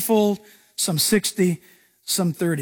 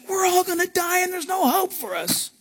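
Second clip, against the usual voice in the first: panicked disciples; 8 semitones higher, 3 dB quieter than usual. Retold or imitated.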